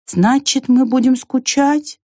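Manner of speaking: slow, high, sad question. A narrator's reading. Squirrel asks the ant if they will miss each other, sad. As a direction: draw the question out sadly. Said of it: "грустно сказала белка".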